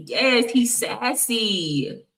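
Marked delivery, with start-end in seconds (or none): none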